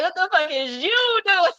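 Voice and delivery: high, slow, incredulous squeak; squeaky mock ancestor voice. Imitating the ancestor's baffled question to her, high and slow. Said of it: "Like, like".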